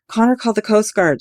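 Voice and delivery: high, loud shout downward. Acted. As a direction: shout down a cliff to him. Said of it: "she shouted down to him".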